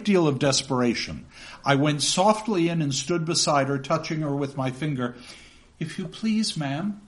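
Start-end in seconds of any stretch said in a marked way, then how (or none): 5.8-7.0 put-on higher, softer pleading boy; a small boy's hesitant plea; timid boy. Runaway boy timidly addressing his aunt, higher and softer. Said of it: "I began".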